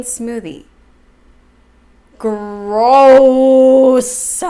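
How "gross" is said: disgusted. Real, not acted. Reacts to the breakfast.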